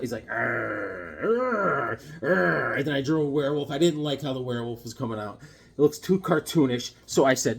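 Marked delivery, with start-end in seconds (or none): 0.3-2.7 repeated drawn-out monster growls; Frankenstein monster growl. Acted. Showing his Frankenstein drawing, imitated monster growl. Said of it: "I liked how the Frankenstein".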